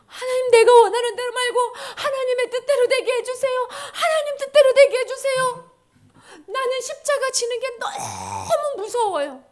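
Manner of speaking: high, loud, strained pleading; fervent prayer. Acted. Jesus praying to God before the cross, fervent pleading. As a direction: beg with all your might. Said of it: "너무 기도를 열심히 해가지고".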